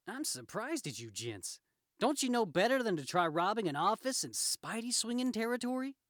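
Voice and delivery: lively, taunting superhero voice; cocky Spider-Man. Acted. Spider-Man to the robbers in his territory, cocky. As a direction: needle the crooks like a wisecracking hero.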